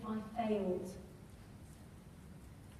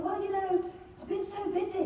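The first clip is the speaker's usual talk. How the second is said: sheepish excuse; 8 semitones higher than usual. Retold or imitated. Caught out, making excuses.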